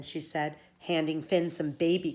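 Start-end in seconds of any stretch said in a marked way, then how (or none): none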